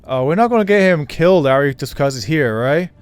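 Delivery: ordinary talk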